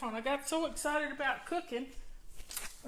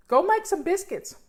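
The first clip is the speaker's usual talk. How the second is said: usual speaking voice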